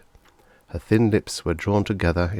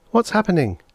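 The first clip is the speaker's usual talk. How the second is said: put-on higher puzzled young boy; boy echoing; 12 semitones higher, 4 dB louder than usual. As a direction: repeat it as a curious kid. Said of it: "Tony echoed".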